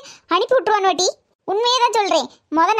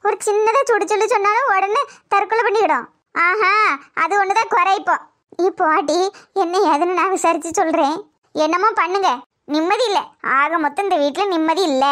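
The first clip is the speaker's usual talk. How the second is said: ordinary delivery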